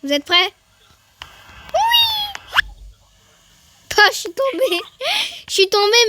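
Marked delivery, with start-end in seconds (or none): 1.7-2.4 excited shout. Real, not acted. Shouts ready before jumping. 4.0-6.0 laughing shout. Real, not acted. Falls in the game.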